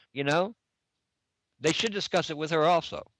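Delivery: ordinary delivery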